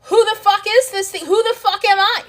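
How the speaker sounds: high, loud alarmed disbelief; the teen self hearing it must die, yelping in alarm; shocked outburst. Retold or imitated. Ego teen told to die, loud high shock. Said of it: "They go".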